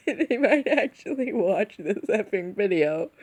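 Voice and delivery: ordinary delivery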